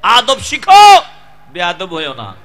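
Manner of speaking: loud, high preaching at full force; fiery sermon. Acted. Preacher thundering at the crowd, loud and high. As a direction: hammer the lesson home like a waz preacher.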